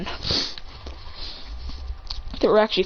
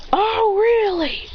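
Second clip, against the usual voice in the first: high, slow mock disbelief; challenging retort; 11 semitones higher, much slower than usual. A narrator's reading. Teacher answering a student's insult, challenging retort.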